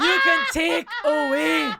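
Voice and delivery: loud, high, drawn-out mock Scottish; mock Scottish accent. Cohost riffing on the monologue, hammed-up Scottish accent. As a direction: join in with a big Scottish holler.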